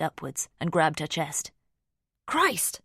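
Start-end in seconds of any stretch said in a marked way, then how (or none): 2.3-2.8 sudden high startled cry; startled gasp of a word. A narrator's reading. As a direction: jump out of her skin on it. Startled by Finn in the dark.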